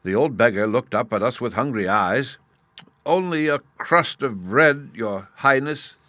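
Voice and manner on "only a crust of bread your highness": slow, high humble plea; pleading. A narrator's reading. Old beggar begging the prince, slow plea. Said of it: "he pleaded".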